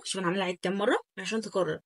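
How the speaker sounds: ordinary talk, nothing marked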